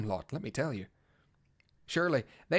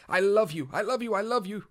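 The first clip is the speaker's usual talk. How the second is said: higher, louder elated repeats; joyful declaration; 6 semitones higher, 3 dB louder than usual. Acted. Bard rejoicing as he declares love, elated. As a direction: burst out with joy. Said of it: "wonderful it is to say it aloud".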